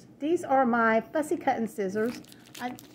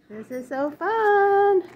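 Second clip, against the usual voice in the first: delighted; 8 semitones higher, 4 dB louder, slower than usual. Real, not acted. Delighted opening a party box.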